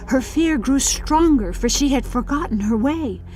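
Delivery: ordinary delivery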